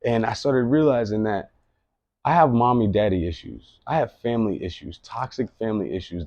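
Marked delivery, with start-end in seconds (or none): none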